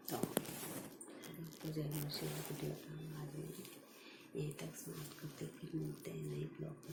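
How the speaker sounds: very soft, near-whispered goodbye so the house stays asleep; barely above a whisper, signing off; whispering goodbye while everyone sleeps; hushed sign-off. Real, not acted. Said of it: "उठ के मैं धीरे धीरे बोल रही हूँ".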